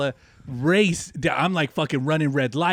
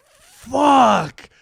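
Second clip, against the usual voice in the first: mock exclamation; 10 semitones higher, 9 dB louder than usual. Retold reaction in bed.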